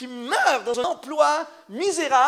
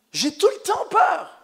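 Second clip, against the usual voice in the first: mock fearful shout; 6 semitones higher than usual. Preacher mocking a fearful life with a slow shout. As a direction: shout it like someone living in fear. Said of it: "une personne qui va développer un style de vie de peur".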